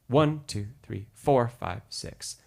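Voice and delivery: "One" and "four" leaned on, "five, six" almost unvoiced; stress on one and four. Shown as a demonstration. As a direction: count with weight on "One" and "four". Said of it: "Four is the second strongest beat".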